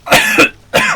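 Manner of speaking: high, explosive coughing burst; loud cough. Real, not acted. Player recovering from doing the creature voice, loud cough. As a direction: cough it out hard. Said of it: "tricked into, uh, doing that voice".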